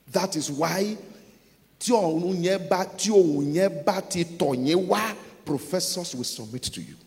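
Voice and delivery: loud, declarative sermon delivery; emphatic pulpit declarations in bursts; preaching at full force. Real, not acted. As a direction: proclaim each promise forcefully.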